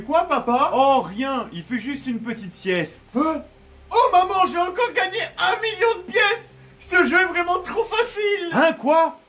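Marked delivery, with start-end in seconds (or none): 3.9-8.5 mock over-the-top gamer joy; excited gleeful shout. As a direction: brag about the game win. Kid wins in a game.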